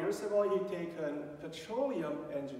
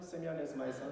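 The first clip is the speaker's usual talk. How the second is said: ordinary talk, nothing marked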